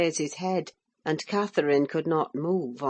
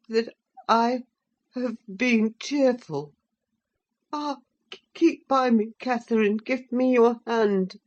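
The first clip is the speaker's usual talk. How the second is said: put-on high, trembling terrified boy; frightened sickly boy; 5 semitones higher, 5 dB louder than usual. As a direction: plead like a feeble frightened child. Voices frail terrified Linton.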